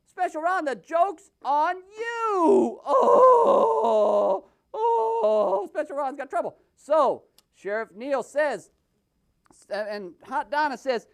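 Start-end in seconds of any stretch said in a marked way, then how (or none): 0.6-5.7 slow, loud hammed-up wail; mock cry. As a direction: wail in fake despair. Puppet wails.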